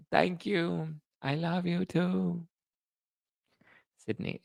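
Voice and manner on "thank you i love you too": high, drawn-out politician impression; Kamala Harris. Shown as a demonstration. Thanking viewers once more, high, slow politician impression.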